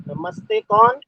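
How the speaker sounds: ordinary talk, nothing marked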